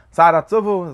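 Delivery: ordinary talk, nothing marked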